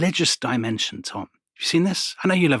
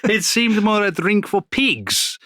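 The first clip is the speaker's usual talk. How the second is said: high, slow, hammed-up Italian accent; 10 semitones higher, 4 dB louder, slower than usual. Historian quoting Benzoni on chocolate, hammed-up Italian accent. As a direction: sneer it out in a mock Italian accent. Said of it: "I will".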